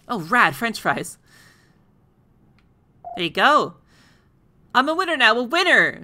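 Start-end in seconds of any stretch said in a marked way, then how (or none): 4.7-6.1 triumphant. Real, not acted. Triumphant shout.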